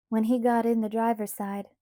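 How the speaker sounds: ordinary delivery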